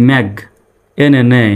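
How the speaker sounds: ordinary delivery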